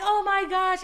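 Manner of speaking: high thrilled gasp of joy; excited squeal. Retold or imitated. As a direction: squeal along with your friends. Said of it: "we're like".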